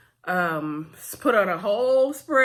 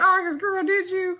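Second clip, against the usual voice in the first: high, louder sassy call-out; sassy disbelief; 11 semitones higher, 4 dB louder than usual. Real, not acted. Vlogger calling out August's lie, high sassy disbelief. Mocking August's claim in retelling.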